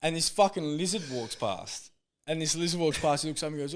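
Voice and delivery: ordinary talk, nothing marked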